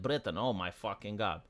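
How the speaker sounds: low, quiet groan of disgust. Real, not acted. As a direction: sink into exasperation. Disgusted reaction to Britain.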